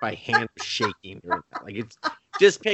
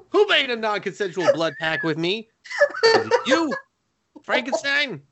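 a hint of an indignant vampire scolding his butler; hammed-up scolding, laughing at first; mock outraged master; 6 semitones higher than usual. Mock tirade at Frankenstein.

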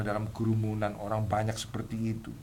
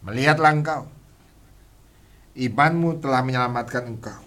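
proclaiming; 2 semitones higher, 7 dB louder than usual. Retold or imitated. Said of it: "berkata begini".

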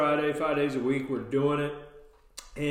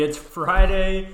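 slow, loud, higher Friday cheer; hyped Friday; 5 semitones higher, 7 dB louder, much slower than usual. Acted. Host hyping listeners up for the weekend, loud cheer. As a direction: sell the weekend like a hype man.

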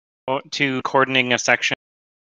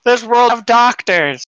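raised, louder character voice; hammed-up lament; 10 semitones higher, 4 dB louder than usual. Patient answering about medication, hammed-up lament. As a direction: lament it loudly, pitched well up.

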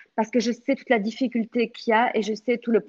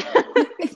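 bright burst of real laughter, high up; laughing; 11 semitones higher, 4 dB louder than usual. Real, not acted. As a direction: laugh openly at yourself. Rit de sa sympathie. (éclat de rire sincère)

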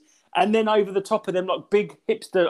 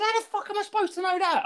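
exasperated retort; 3 dB quieter, faster than usual. Retold or imitated. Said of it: "getting really wound up".

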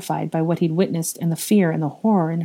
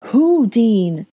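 higher, louder, insistent demand; 5 semitones higher, 5 dB louder than usual. Acted. Ginny demanding Dean name the dead, insistently. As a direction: press him hard for a name. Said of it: "Ginny demanded".

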